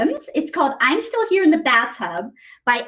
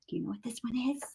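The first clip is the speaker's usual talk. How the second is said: whisper; 4 semitones lower, 15 dB quieter, much faster than usual. Real, not acted. Teasing the kids about the song.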